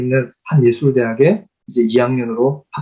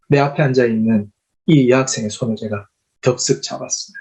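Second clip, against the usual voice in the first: usual speaking voice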